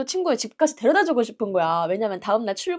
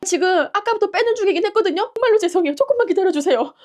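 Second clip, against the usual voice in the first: high, loud, apologetic plea; re-enacted panicky apology; acted flustered pleading; 9 semitones higher, 5 dB louder than usual. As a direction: plead for patience in a raised voice. Driver pleading with waiting couple.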